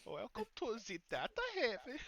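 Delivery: high, heavy mock German accent. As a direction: greet like a German vendor. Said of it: "heavily accented German".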